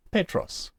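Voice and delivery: "Petros" with the first syllable stressed; "Petros" said as PET-ros, accent up front. Shown as a demonstration. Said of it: "we stress that syllable".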